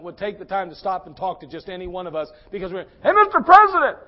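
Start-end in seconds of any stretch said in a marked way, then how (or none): 3.0-4.0 loud, high holler over a crowd; desperate bystander's shout; shouting for attention. Acted. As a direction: yell insistently to a passing leader.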